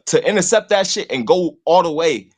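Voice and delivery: ordinary talk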